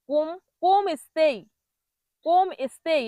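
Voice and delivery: কোম cut short, then স্তেই with "ei"; common mispronunciation. Shown as a demonstration. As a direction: say কোম স্তেই as "kom estei". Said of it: "এরকম বলে".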